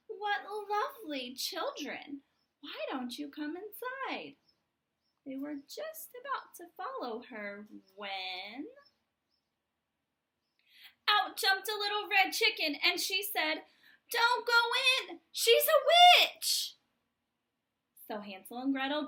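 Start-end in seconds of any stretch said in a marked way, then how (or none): 0.1-4.4 lightly voiced sugary old woman, a touch higher; sweet old witch. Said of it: "the old woman who lived there came out and said". 14.1-16.8 high, loud, slow panicked cry; frantic shout. Acted. Chicken bursts in warning.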